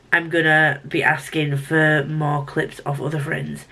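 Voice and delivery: ordinary talk, nothing marked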